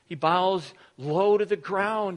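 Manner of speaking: ordinary talk, nothing marked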